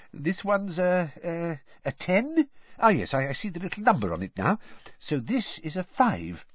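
high, hesitant, halting then rushed; fumbling wizard. A narrator's reading. Mister Weasley puzzling over Muggle money, hesitant and high. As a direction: stumble over strange notes.

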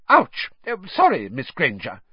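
high startled ouch, then a hurried apology; yelp, then apologise quickly; flustered teacher. A narrator's reading. McGonagall apologises to Hermione after grabbing her, flustered.

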